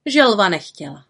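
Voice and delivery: usual speaking voice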